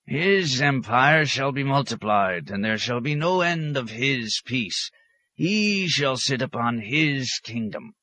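high, slow, drawn-out proclamation; chant-like scriptural pronouncement; prophesying hermit. A narrator's reading. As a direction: intone it like a desert prophet. Hermit proclaims prophecy with burning eyes.

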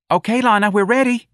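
put-on high-pitched, loud girlish call; high, projected character voice; calling from off stage. A narrator's reading. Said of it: "Darlene called off stage".

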